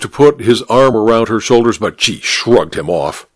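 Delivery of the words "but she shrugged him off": plain reading voice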